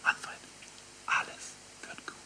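soft whispered reassurance into a friend's ear. Acted. Preacher whispering comfort into Manfred's ear, whispered. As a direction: breathe it softly right into his ear. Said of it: "ihm ins Ohr flüstere und sage".

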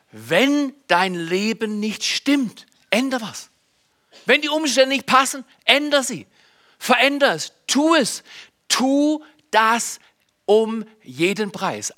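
hohe Predigerstimme, Satz für Satz gesetzt; eindringlicher Appell. Real, not acted. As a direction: die Gemeinde zum Handeln drängen. Drängender Appell an die Gemeinde. (eindringlich appellierend)

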